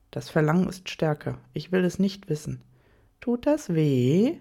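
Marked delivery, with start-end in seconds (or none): 3.2-4.4 slow lover's question. A narrator's reading. Lover asking his bound partner about pain, slow. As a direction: ask it slowly and with weight.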